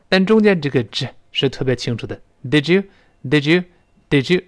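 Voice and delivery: normal talking voice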